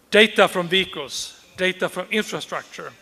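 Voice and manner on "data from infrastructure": ordinary delivery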